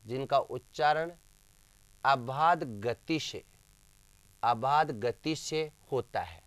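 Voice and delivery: slow, spaced-out dictation of a definition; deliberate repetition. Shown as a demonstration. Teacher repeating the definition for students, slow and deliberate. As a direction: repeat it slowly, phrase by phrase, with long pauses. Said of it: "फिर repeat कर रहा हूँ".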